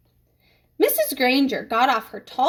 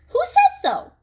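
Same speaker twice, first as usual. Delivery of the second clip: challenging question; 4 dB louder than usual. Acted. Nick pushing his challenge question.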